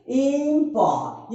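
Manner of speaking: इम्पॉ very slow, as इम-पॉ; drawn-out syllables. Shown as a demonstration. Teacher breaks the word for students, इम्पॉ drawn out. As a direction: stretch both beats of इम्पॉ. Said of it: "important के तोड़े तो कितना बार में?".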